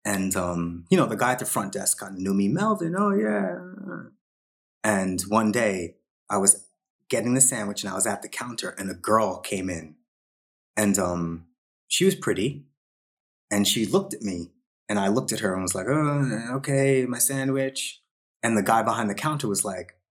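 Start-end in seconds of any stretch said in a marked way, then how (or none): 2.5-3.6 high, slow mock greeting; lightly voiced cheery deli guy; deli guy's greeting. 15.9-18.0 higher, slow flustered mumble; awkward distracted reply. Flustered by pretty girl.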